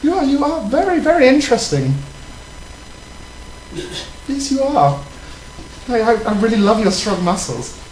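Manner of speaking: prim, proper British lady voice; British accent, ladylike; put-on British accent. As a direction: play a posh English lady flirting. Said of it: "the accent is annoying the crap out of me".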